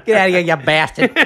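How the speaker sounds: loud Boston yell, laughing; Boston accent. Pretend. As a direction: shout it like a Boston tough guy. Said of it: "Need to work on my Boston accent".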